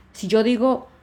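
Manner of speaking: normal talking voice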